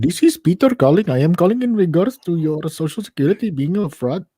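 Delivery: scam caller in a put-on Indian accent; hammed-up Indian-accented phone operator; mock Indian accent. Imitating a scam operator calling a woman, mock Indian accent. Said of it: "spoke in his bullet bullet accent".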